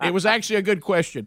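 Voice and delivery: usual speaking voice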